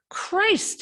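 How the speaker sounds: sudden high yelp of pain; burned, blurt it out; pained cry. Acted. Hand pressed on hot stove.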